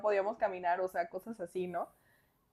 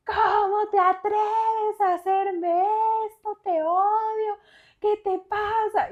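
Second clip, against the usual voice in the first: much higher, loud, drawn-out outraged accusation; furious outburst; 11 semitones higher, 4 dB louder, much slower than usual. Retold or imitated. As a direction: scream it at her mother over the phone. Furious phone call to mom. (reclamo furioso y agudo)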